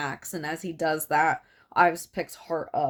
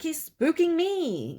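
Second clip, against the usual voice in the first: high, slow uneasy whine; nervous complaint; 11 semitones higher than usual. Retold or imitated. Toffler complaining about the spooked captive, high and uneasy. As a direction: say it much higher, creeped out. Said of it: "And he's like".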